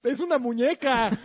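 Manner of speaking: loud. Real, not acted. Joking exclamation, laughs.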